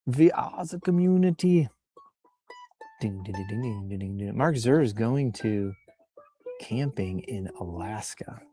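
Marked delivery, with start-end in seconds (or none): none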